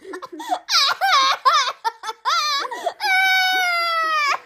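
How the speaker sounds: high, loud laughing fit; shrieking laughter. Real, not acted. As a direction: burst out laughing hard. Reacting to friend's mishap.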